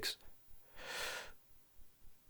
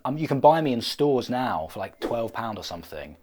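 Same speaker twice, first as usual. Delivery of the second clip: normal talking voice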